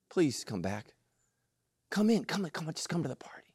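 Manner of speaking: soft, pleading, fading into a whisper; a father coaxing his son in, hushed; begging whisper. Acted. Father begging the son to come in, whispering. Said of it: "And he begged him".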